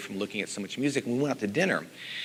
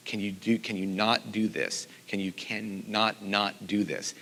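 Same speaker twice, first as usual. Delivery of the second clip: ordinary delivery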